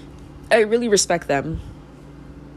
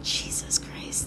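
a weary, disbelieving whisper; exasperated whisper; 12 dB quieter, slower than usual. Retold or imitated. Reacting to European history, in a slow whisper.

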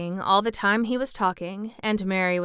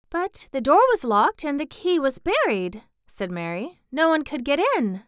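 put-on higher, louder girlish objection; protesting girl; 7 semitones higher, 4 dB louder than usual. Mary insisting the door was locked, protesting.

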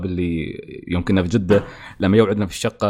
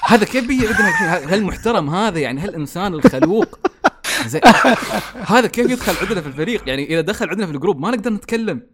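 mock disbelief; 9 semitones higher than usual. Mock disbelief about polite newcomer.

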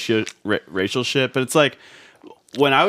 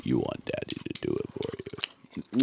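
deep, slow, soft daddy croon; low teasing daddy voice; 13 semitones lower, 10 dB quieter, slower than usual. Pretend. Repeats the teasing line slower.

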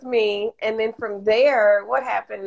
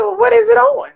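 incredulous; 14 semitones higher, 6 dB louder than usual. Retold or imitated. Retold protest at firing.

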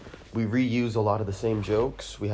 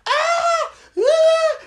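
very high, loud screams; mock panicked screams; 14 dB louder than usual. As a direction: shriek in pretend panic, short bursts. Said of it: "Everybody's freaking out".

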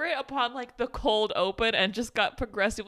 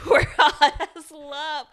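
slow, louder, laughing through it; laughing quote; 4 semitones higher, 8 dB louder, slower than usual. Retold or imitated. Quoting Reg's silly line to her cohost, laughing. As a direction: crack up while quoting the line.

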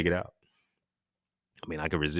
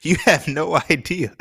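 high, loud and slow amid laughter; laughing exclamation; 9 semitones higher, 9 dB louder, slower than usual. Real, not acted. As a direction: exclaim it loudly through amusement. Knowing retort amid laughter.

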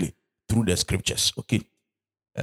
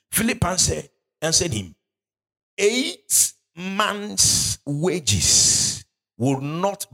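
higher, slow scripture reading; 4 semitones higher, slower than usual. Acted. Preacher reading Philip's answer to the church, high and slow. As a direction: raise the voice and drag the verse out. Reads NIV verse.

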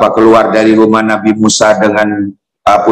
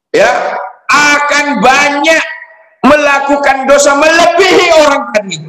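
Jibril warning. Acted. Angel Jibril warns Musa about the end times, much higher stern voice. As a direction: warn him like a stern angel.

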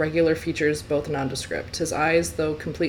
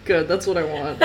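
ordinary delivery

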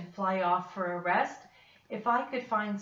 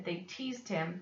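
ordinary delivery, nothing marked

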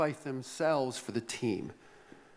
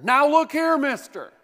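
high, loud angry snap; heated reply; 10 semitones higher, 8 dB louder than usual. Acted. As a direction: bark it at the caller. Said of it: "came a heated reply".

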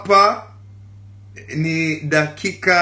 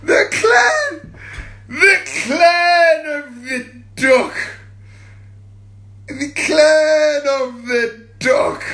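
wailing shout; 8 semitones higher, much slower than usual. Pretend. Crying out the catchphrase to her followers. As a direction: wail it like a mock lament.

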